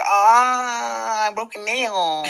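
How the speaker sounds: high, whiny mock cry over a broken nail; mocking whiny complaint. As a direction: whimper it like a softie, making fun. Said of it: "I'm not like".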